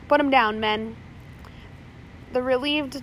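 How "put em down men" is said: shouted order. A narrator's reading. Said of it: "Slank shouted to his crew".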